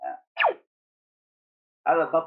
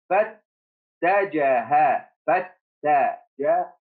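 فاتجه drawn out slowly; slow reading; 3 dB louder, much slower than usual. Shown as a demonstration. Teacher modelling فاتجه slowly for the class. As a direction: stretch each sound of فاتجه. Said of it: "teacher models reading".

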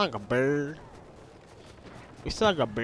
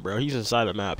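ordinary talk

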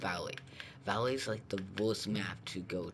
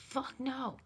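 squeaky yelp. Pretend. High squeak at enemy.

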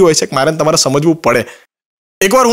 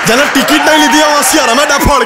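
indignant outburst; 6 semitones higher than usual. Real, not acted.

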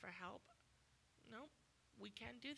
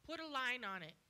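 ordinary talk

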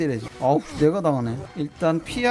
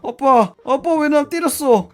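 put-on higher, slow plaintive child's question; a hurt kid asking why dad hit him; whiny son; 8 semitones higher, 4 dB louder than usual. Streamer voicing the son asking his father why, lightly whiny.

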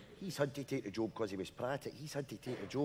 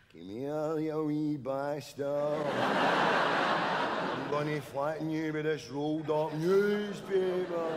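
1 slow ghostly voice; 3 semitones higher, 4 dB louder, much slower than usual. Retold or imitated. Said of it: "Then a voice".

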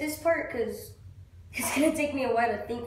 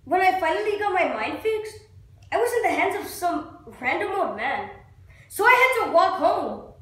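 raised pitch, building to loud; acted grievance; 4 semitones higher, 3 dB louder than usual. Actor tells God he had to walk home, building grievance. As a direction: pile up the grievance, ending forcefully.

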